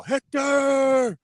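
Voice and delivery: high, drawn-out bellowed call; movie battle yell. Retold or imitated. Imitating Brad Pitt calling out Hector from outside, drawn-out yell. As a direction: holler it like a challenge at the gates. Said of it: "Brad Pitt yell".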